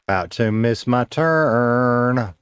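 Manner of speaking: half-speed, stretching "turn" into a long held sound; drawn out. Real, not acted. Streamer playfully repeating about a missed turn, drawn out.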